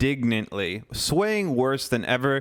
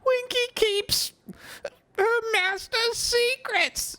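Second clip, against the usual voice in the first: very high, slow, stubborn squeak; mutinous elf; slower than usual. Acted. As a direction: dig in your heels, little elf. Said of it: "she said mutinously".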